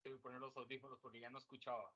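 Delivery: distant, faint voice far from the microphone; far from the mic. Real, not acted. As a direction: speak from across the room, away from the mic. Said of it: "Yo te oigo muy lejos".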